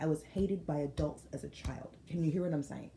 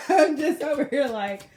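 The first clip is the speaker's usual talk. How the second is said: high, loud laughing words; 9 semitones higher, 9 dB louder than usual. Real, not acted. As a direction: laugh right through it. Laughing at the reading.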